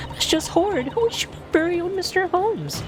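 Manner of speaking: prim onlooker aghast; high gossiping spectator voice; shocked gallery. Acted.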